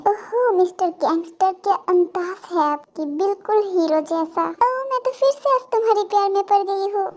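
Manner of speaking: put-on swooning, admiring girl voice; smitten teasing girl. Girl praises gangster who rescued her, smitten. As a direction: gush over your hero, lilting upward.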